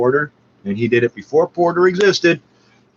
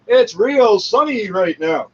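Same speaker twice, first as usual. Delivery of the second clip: slow, higher mock forecaster voice; mock weather guy; 6 semitones higher, slower than usual. Comic mocking the radio weather guys, slow and higher. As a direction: drag it out like a dim radio weatherman. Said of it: "and they always get it".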